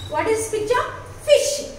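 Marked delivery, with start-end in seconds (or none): 1.2-1.7 Fish said high and bright with a short clipped i; teacher's clear model of Fish; model word fish. Shown as a demonstration. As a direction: say Fish with a quick short vowel. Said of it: "when we are pronouncing for fish".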